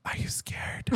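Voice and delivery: breathy unvoiced whisper in the ear; creepy whispered question. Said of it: "and he whispers".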